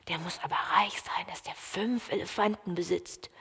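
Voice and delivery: hushed, amazed whisper; awed whisper. Acted. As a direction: whisper it in awe. Awed onlookers whisper together.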